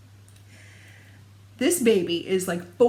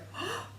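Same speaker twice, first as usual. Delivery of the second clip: delighted gasp; 13 semitones higher, 13 dB quieter than usual. Retold or imitated. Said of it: "And I was like".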